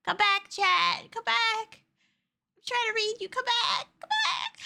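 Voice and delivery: rising whiny "come back"; whiny plea. Pretend. Pleading chat to come back.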